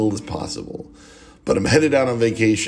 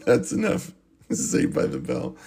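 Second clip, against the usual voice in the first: laughing; 3 semitones higher, 3 dB quieter than usual. Real, not acted. Still laughing.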